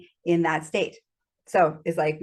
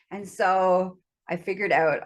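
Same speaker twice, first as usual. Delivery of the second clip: ordinary delivery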